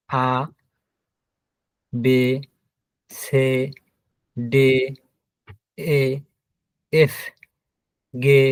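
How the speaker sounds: each German letter name said slowly and separately, আ, বে, সে, ডে; German alphabet recited. Shown as a demonstration. Teacher reciting the German alphabet for the class. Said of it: "যেমন".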